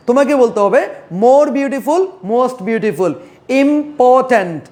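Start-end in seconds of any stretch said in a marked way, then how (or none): 3.6-4.6 important split as im, por, tant; teacher sounding out important by syllable; broken into syllables. Shown as a demonstration. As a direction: say important in three separate beats. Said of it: "তিন চার syllable আছে".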